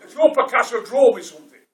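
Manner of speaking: acting out a bold kid's demand; loud challenge. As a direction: call out a cheeky challenge. Retold childhood challenge to Picasso.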